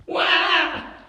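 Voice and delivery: put-on loud high baby cry; baby wail. Baby crying in library.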